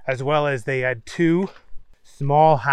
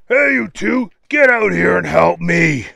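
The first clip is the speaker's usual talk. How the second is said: put-on boss call; 3 semitones higher, 6 dB louder than usual. A narrator's reading. Dad luring two more bad guys out, loud put-on call. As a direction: holler for the henchmen like their boss. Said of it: "Their dad made the same call".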